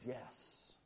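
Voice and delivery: soft unvoiced whisper, very quiet; whispered yes. Pastor voicing God's answer to the congregation, hushed whisper. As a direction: whisper it like a secret reply. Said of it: "And God says".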